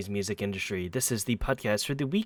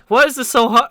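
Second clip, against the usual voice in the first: frustrated yell, much higher and louder; 16 dB louder than usual. Retold or imitated. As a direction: shout it out in exasperation. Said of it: "I was yelled at earlier about that".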